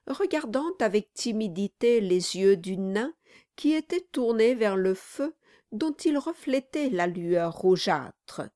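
usual speaking voice

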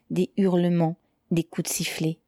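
usual speaking voice

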